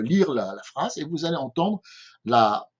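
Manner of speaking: usual speaking voice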